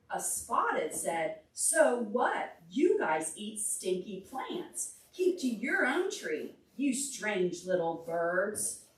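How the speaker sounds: lively read-aloud to a class; story reading for children. Acted. Teacher reading a picture book to the class, lively storytime voice.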